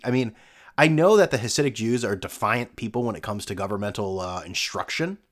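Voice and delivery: ordinary talk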